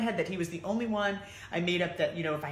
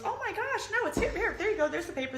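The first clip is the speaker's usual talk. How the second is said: a much higher, bubbly helper voice with a laugh; lightly voiced chirpy accountant; cheerful accountant. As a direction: play her as eager and delighted to help.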